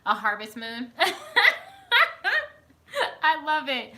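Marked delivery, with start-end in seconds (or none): 1.0-2.5 high squealing laugh breaking off; high-pitched laugh that nearly tips into tears; big laugh. Real, not acted. Laughs at harvest moon. 3.2-3.9 laughing. Real, not acted. Laughing after harvest moon.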